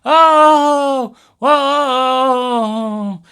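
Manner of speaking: high, loud hammed-up wailing; mock sobbing mother. Mother playing her trump card, hammed-up high sobbing. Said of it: "which is to burst into tears".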